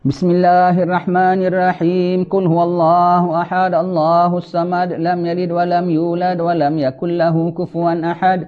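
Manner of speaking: chanted, steady recitation; Quran recitation. Acted. Imam leading the congregation in recitation, chanted. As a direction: intone each verse.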